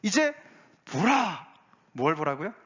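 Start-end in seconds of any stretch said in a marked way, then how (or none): 0.0-1.5 high-pitched summons; crying out. Acted. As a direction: call out like a herald breaking the news. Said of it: "외치는 소리가 있는데".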